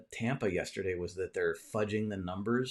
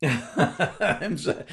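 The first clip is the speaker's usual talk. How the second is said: laughing; 7 semitones higher, 6 dB louder than usual. Real, not acted. Laughing at viewer's comment.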